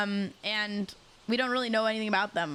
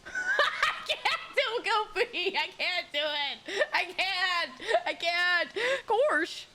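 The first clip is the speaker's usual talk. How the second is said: high, slow, breaking into laughter; can't stop laughing at yourself; real giggling fit; laughing helplessly; 6 semitones higher, much slower than usual. Real, not acted. Laughing at failed voice.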